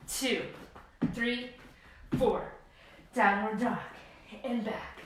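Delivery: ordinary talk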